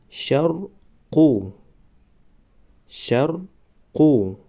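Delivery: شرقو stretched very slowly, syllable by syllable; drawn-out demo. Shown as a demonstration. Teacher modelling the word for students, very slow. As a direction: draw out شرقو slowly for the class. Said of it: "ما باید همان حرف را فقط آوازش را تلفظ کنیم".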